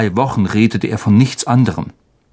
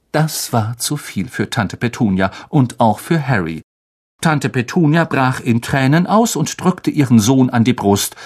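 ordinary delivery

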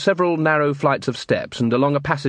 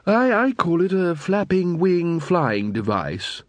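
timid inventor; 5 semitones higher, slower than usual. Acted. Leonard explains, slow.

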